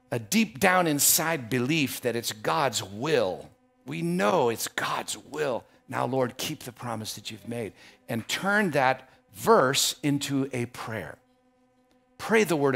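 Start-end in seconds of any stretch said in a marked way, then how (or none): none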